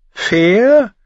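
high, loud scornful echo; sneering. Acted. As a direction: throw the word back with contempt. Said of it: "höhnte Darion".

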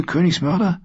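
hoch und spitz, mit fragend steigendem Ende; Königsmörder? hoch und höhnisch in die Höhe gezogen. Acted. Brienne verhöhnt Jaime. (Brienne verspottet Jaime, höhnisch hoch gefragt)

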